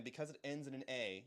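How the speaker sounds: ordinary delivery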